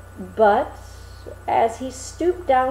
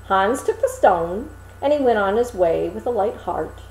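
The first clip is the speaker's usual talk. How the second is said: ordinary delivery, nothing marked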